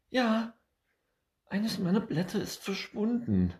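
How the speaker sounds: high, loud, worked-up squirrel voice; agitated squirrel pleading with the bird; upset squirrel. Acted. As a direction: complain breathlessly about a stolen leaf.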